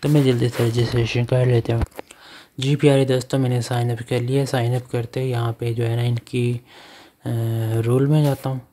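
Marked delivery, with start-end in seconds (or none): none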